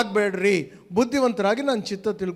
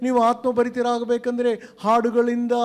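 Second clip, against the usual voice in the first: higher, insistent preaching; 5 semitones higher than usual. Acted. Preacher rebuking idle talk, higher and insistent. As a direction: pull them back to the point.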